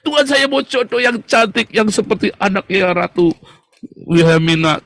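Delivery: hammed-up demand for a pretty bride; a believer mimicked ordering God around, loud and high; demanding mock prayer. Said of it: "Terus ini, gitu".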